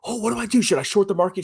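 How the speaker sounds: high mock-panicky investor. Mocking panicky YouTube viewers for his audience, high mock panic. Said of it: "people freaking out".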